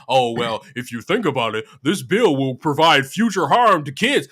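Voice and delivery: low, smug mock voice; a low, condescending imitation of bill critics. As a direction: talk it down like a know-it-all. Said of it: "They start talking about".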